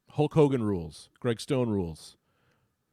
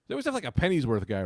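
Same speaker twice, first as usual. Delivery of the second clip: ordinary delivery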